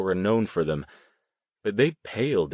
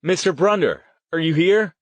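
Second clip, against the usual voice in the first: higher, raised calling voice; 9 semitones higher, 6 dB louder than usual. A narrator's reading. Riley calling to a ghost, calling out. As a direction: call into the room for an answer. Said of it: "Riley called".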